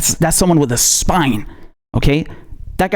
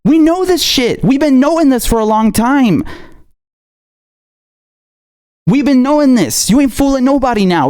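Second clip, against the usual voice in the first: angry; 7 semitones higher than usual. Real, not acted. Angry rant at politician.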